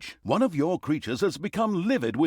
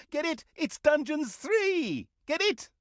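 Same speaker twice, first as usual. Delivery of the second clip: high teasing voice; 13 semitones higher than usual. Acted. Narrator pressing the player to get the pun, squeaky and teasing. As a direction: nudge the listener in a squeaky voice.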